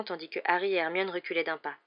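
ordinary delivery

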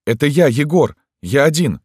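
high, loud plea; urgent call. A narrator's reading. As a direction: shout through the door to be let in. Calling through locked door.

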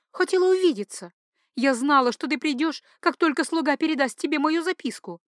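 high-pitched duchess, eager to see her lover; lovestruck duchess, eager. Acted. Duchess greets her lover after sending a note, high and eager. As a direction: play the infatuated mistress in a high voice. Heroine's voice in narrated story.

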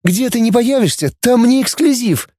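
high woman's character voice; high-pitched excited greeting; exclaiming. A narrator's reading. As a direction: gush it in a high voice. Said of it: "воскликнула она".

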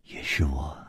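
a cool, quiet admission; flat and calm. Acted. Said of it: "淡淡的说".

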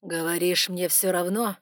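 grind it out; through clenched teeth. Acted. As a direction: dragon forcing words through his teeth. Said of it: "продолжал он сквозь зубы".

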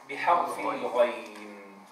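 normal talking voice

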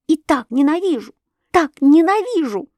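high, intense repeated declaration; passionate hatred. A narrator's reading. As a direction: vehement but not shouted, rising in pitch. Passionate confession of sudden hatred. (страстно, с ожесточением)

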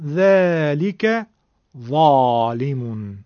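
ذالک ظالمون with a thick, heavy ظ, drawn out slowly; slow teacher demo of the emphatic ظ in ظالمون; heavy emphatic letter demo. Shown as a demonstration. Teacher shows students the heavy ظ sound, slow and thick. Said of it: "اینطور ببینید".

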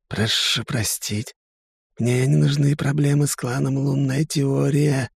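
high, slow, cowering hiss; hissing serpent dragon. A narrator's reading. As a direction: hiss an apology, shrinking back. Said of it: "прошипел он".

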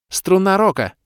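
very high, loud incantation; spell cry. A narrator's reading. Kalen casting while on her knees, high loud cry. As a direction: shout the spell name.

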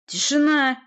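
high, loud call for silence; demanding shout. A narrator's reading. Sorceress demands silence from noisy boyars, demanding shout. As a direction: demand silence over the uproar. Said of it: "потребовала колдунья".

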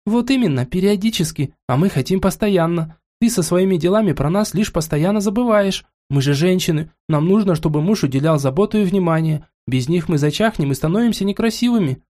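put-on high, playful spoiled young wife; girlish voice pressing her point; капризная жена. Cecilia voice, echoing периодически. (игривая капризная девушка)

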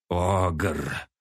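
put-on old orc snarling the word; growled hiss. A narrator's reading. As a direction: spit it out with a growl. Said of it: "только и прорычал, прошипел престарелый орк".

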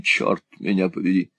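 ordinary delivery

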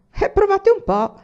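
put-on high, loud boyish bravado; cocky. A narrator's reading. Boy daring his father to try, cocky and defiant. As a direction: dare him, full of swagger. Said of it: "disse egli spavaldo".

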